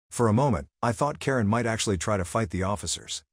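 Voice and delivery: plain reading voice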